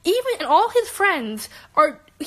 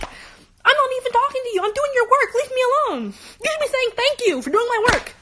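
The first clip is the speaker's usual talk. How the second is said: much higher, indignant retold scolding; exasperated scolding; 11 semitones higher than usual. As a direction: tell him off, high and indignant.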